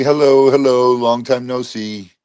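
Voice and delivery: ordinary talk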